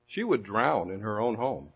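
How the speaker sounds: ordinary delivery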